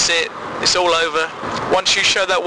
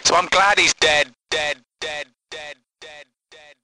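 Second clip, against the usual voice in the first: gleeful fade-out. Acted. Gleeful dead, fading.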